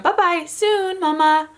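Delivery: high "Bye-bye, soon, Mama"; toddler voice. Retold or imitated. Mom imitates her daughter in the crib, high toddler voice. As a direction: lift it high like a tiny child. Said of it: "She went".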